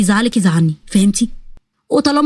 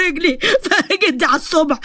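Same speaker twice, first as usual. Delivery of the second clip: pained cry; 3 semitones higher than usual. Acted. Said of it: "فجأة صرخ".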